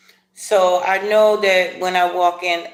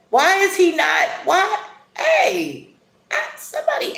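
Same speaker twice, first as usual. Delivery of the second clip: high pleading whine, much higher; mock lovesick whine; 13 semitones higher than usual. Pining vlogger whines to the absent crush, high and pleading.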